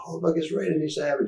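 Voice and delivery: high, excited retold exclamation; starstruck recognition. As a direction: spot a celebrity, acted out. Police recognizing a celebrity, acted out.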